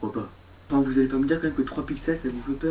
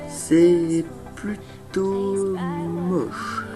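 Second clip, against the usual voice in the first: drawn out; 3 semitones higher, much slower than usual. Acted. Deadpan verdict on ugly graphics.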